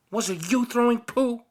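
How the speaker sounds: put-on a small child accusing from a bush; child asking from hiding who threw it; child's voice. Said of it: "the voice was definitely a child's".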